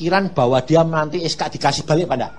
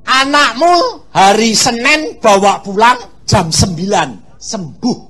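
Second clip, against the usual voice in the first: God's promise; 7 semitones higher, 9 dB louder, slower than usual. Retold or imitated. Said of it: "Dia ngomong".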